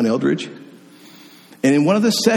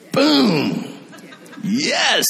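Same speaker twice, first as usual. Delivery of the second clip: high, laughing shout of victory; a joyful high whoop with laughter; triumphant cheer; 7 semitones higher than usual. Real, not acted. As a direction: celebrate the win with a laugh. Triumph at her fun.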